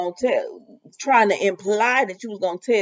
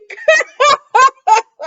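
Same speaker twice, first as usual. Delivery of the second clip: high shriek of laughter; 8 dB louder than usual. Real, not acted. Vlogger laughing at the thought of hot chili. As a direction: burst of squealing laughter.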